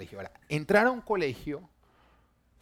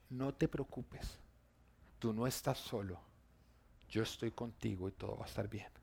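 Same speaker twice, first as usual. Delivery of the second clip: gentle inner voice; 4 semitones lower, 10 dB quieter than usual. Retold or imitated. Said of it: "escucho una voz que me habla a mi corazón". (voz interior suave y lenta, tranquilizadora)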